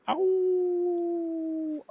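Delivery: a long, high wolf howl at full voice. Retold or imitated. Coworker in a trance howls with the wolves, high howl. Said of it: "He's going".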